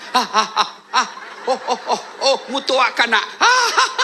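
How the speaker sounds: rising hammed-up yelps; mock pained cries. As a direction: act the nursing mother's pain. Mimicking teething baby pain. (teriakan kesakitan yang dilebih-lebihkan)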